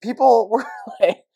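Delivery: can't hold back the giggles; laughing. Real, not acted. Cohost cracking up retelling the restaurant reaction. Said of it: "Okay, I'm kinda laughing".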